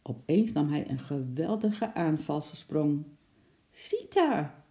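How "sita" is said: high, startled cry; high-pitched shriek of fright, voiced in storytelling; frightened yell. A narrator's reading. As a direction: yell the name in alarm. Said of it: "gilde Lotje geschrokken".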